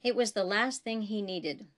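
ordinary delivery, nothing marked

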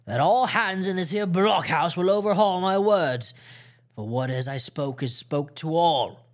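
pirate raising his voice to the listening crew. A narrator's reading. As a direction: project it to everyone in the house. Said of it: "raising his voice".

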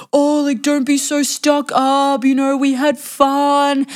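high, stretched-out mocking imitation; mock wheedling date. Date brushes off her complaint of harassment, mock high wheedling.

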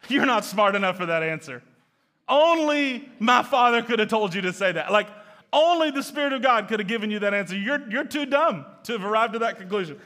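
a loud, teasing rebuke; Jesus ribbing Peter, loud and playful; voicing Jesus. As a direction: tease him loudly, fondly, as Jesus would. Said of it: "And Jesus looks at him and says".

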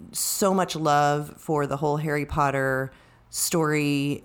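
ordinary talk, nothing marked

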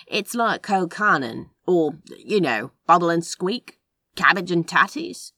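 lightly Scottish, offhand explaining food; light Scottish accent. A narrator's reading. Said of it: "Crowley didn't have much of a Scottish accent, just a slight softening".

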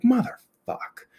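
slow, drawn-out curse in mock despair; slow, high-pitched exasperation; exasperated. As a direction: stretch the swear out in retold dismay. Dismayed reaction to bad tire news.

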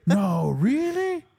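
slow, high fake astonishment; sarcastic disbelief. Answering something obvious, sarcastically astonished. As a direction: stretch it out in mock amazement. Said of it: "that's what it is now".

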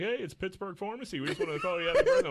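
high, loud pharmacy-caller imitation; chirpy caller. Pharmacy calling with birthday wishes, mock-chirpy. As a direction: put on a sing-out phone greeting.

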